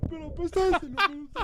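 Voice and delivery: high, loud mocking Felix voice; mock his friend's whiny interruption; imitating Felix. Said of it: "And I hear Felix".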